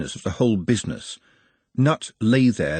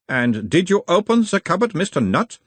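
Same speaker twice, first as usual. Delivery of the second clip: put-on a mild Uberwaldian accent, the higher voice of a gentle examiner; 9 semitones higher than usual. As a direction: question him in a light Uberwald accent, calming the patient. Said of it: "in a mild Uberwaldian accent".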